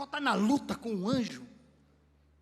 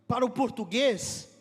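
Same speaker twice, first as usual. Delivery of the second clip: ordinary delivery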